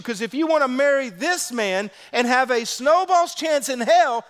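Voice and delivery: high, loud preacher's drive; full-force preaching. Acted. Pastor preaching to the congregation, full force. Preaching opening, voicing Jesus.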